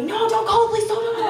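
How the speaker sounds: high, urgent begging not to call; panicked pleading. Retold or imitated. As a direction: imitate a frightened man begging. Man panicking about the police.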